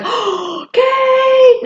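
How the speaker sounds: gasping, high, loud cry of her name; imitating her friend's delighted recognition; excited greeting. Friend spots Kate walking in, delighted high cry. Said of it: "she was like".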